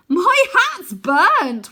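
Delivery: high, loud, slow and gleeful; laughing boy. Acted. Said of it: "Wynne laughs".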